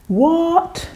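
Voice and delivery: high, startled "what"; surprised. Retold or imitated. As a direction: caught off guard by the jacket. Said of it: "I thought to myself".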